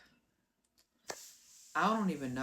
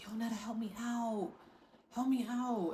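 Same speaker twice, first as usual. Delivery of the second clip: imitated plea for help; 6 semitones higher than usual. Friend at the window asks to be let in, pleading. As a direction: plead in a raised voice, stretching it.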